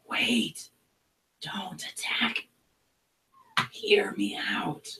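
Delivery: high, slow whispered goblin plea. Acted. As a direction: plead urgently in a tiny raspy goblin hush. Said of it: "you hear it say".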